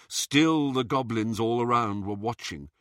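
ordinary delivery

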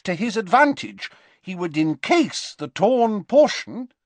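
high, emphatic professor voice; uneasy professor lecturing. Acted. Professor explains murder rips the soul apart, grave and agitated. Slughorn explains Horcruxes, acted.

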